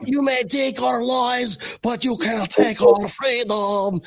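a horrible mock Scottish accent, slowly declaimed; bad Scottish accent. Host jokingly opens show as Braveheart, mock Scottish accent. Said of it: "my horrible take on, uh, my Scottish accent".

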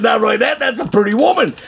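high-pitched alarmed objection; comic protest. Retold or imitated. Man objects to God's errand, comic protest. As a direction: plead your case in a high, indignant voice. He argues back with God.

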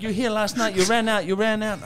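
high, loud mock accusation; imitated accusing staff. Hospital staff recognising a bill dodger, high loud imitation. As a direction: pitch it right up and point the blame.

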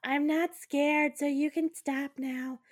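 high, slow insisting she's not scared; frightened bravado. Retold or imitated. Woman in rotating bed tells the ghost she's unafraid, high forced bravado. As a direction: say it to the ghost, voice up and shaky-brave.

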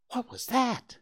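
a small fawn asking, all wide-eyed; excited. A narrator's reading. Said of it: "asked Bambi excitedly".

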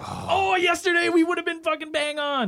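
high, excited outburst. Real, not acted. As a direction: blurt it out in excitement. Excited realisation of date.

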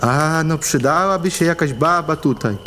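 a touch of dreamy longing. Acted. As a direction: say it dreamily, drifting off. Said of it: "rozmarzonym głosem powiedział Andrzej". (rozmarzony głos)

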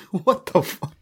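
airy slow laughing "What the fuck?"; laughing disbelief. Real, not acted. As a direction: wheeze it out between laughs.

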